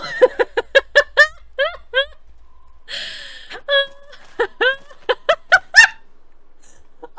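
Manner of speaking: long high wheezing laugh fit; helpless laughing fit. Real, not acted. Streamer in tears laughing at the dollar joke, wheezing fit. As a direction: lose it completely.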